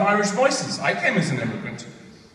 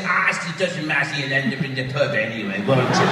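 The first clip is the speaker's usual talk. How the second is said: hammed-up Irish voice, falling lower; mock Irish accent; 4 semitones lower than usual. Comedian answering Irish friends about where to go, mocking Irish accent. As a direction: take the piss with an exaggerated Irish accent. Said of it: "I take the piss out of their Irish voice".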